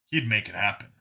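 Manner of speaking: ordinary delivery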